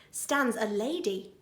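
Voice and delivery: usual speaking voice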